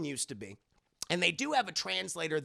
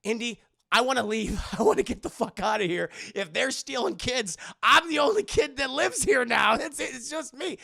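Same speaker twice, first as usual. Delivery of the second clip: mock Short Round panic; 7 semitones higher, 6 dB louder than usual. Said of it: "Short Round's telling Indy".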